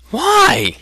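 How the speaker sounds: very high, sharp question; boy taken aback by the outburst; startled. Acted. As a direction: jolt up in shock. Startled youth asks.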